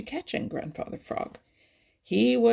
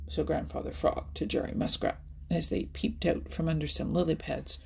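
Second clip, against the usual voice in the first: ordinary delivery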